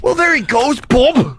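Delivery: put-on high, silly English-accented yell; mock English send-off. Host seeing the intern off in a high mock-English shout. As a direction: holler goodbye in a bad English accent.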